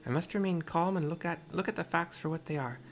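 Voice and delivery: put-on Tepeke's mixed accent. Tepeke scolds the wandering girl in a mixed accent. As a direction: scold the child gently in a put-on foreign accent. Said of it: "the accent I've chosen".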